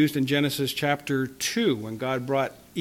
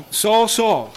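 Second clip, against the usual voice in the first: raised, louder name-calling; emphatic call; 7 semitones higher, 6 dB louder than usual. Retold or imitated. Preacher shows why Saul's name is repeated, emphatic. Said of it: "It's for emphasis and drawing attention".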